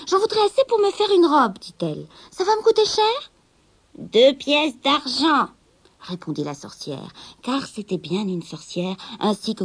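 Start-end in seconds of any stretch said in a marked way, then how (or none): 0.1-1.6 high eager request, louder; young girl. Acted. Rosabelle commande le tissu. 2.3-3.3 high anxious question; young girl. Acted. Rosabelle demande le prix. 4.0-5.5 slow, deliberate price; witch. Acted. La sorcière fixe le prix.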